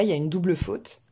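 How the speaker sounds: ordinary delivery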